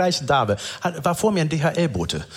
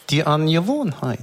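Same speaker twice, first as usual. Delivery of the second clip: ordinary talk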